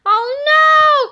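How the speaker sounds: high, loud oh no; alarmed cry. Acted. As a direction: cry out in dismay. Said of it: "cried George".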